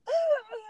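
sharp high shocked gasp. Retold or imitated. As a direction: gasp in disbelief. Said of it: "gasping so hard".

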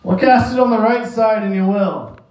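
high, carrying call from the shore; a lightly voiced Jesus calling from the shore; calling out across the water. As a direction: sing it out to the boat like a friendly shout. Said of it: "he calls out to them".

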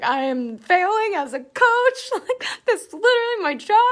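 high, loud wail of self-pity; mock despair. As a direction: cry out in dramatic failure. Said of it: "I was like".

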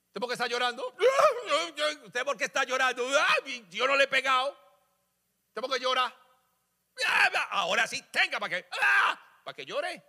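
high, taunting parent voice; preacher imitating a parent scolding a crying child; mock scolding parent. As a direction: tease the crying child with fake concern. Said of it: "así le pegaban a uno".

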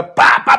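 sharp unvoiced pá pá like gunfire; gunshot sounds. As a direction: spit out imitated gunshots. Imitating gunfire in the story.

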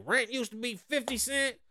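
high-pitched indignant grandfather, switching into Spanish; grandfather grumbling. Retold or imitated. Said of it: "My grandfather was like".

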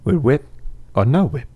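light Cockney film star voice; Michael Caine voice. Pretend. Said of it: "your Michael Caine".

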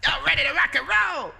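loud, high-pitched crowd-pumping shout; hype man. Acted. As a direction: pump up the crowd like a gig MC. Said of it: "I should be a hype man".

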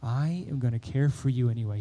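soft, gentle fatherly reassurance; tender voice of God. Acted. God to people who mess up, soft and tender. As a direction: speak softly like a loving father. God speaking to his children.

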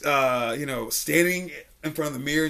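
ordinary talk, nothing marked

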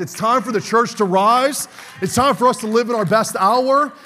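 loud, rising sermon call; preaching at full force. Real, not acted. Pastor rallying the congregation, full-force preaching. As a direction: lift the church with a building cry. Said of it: "never heard preaching like this before".

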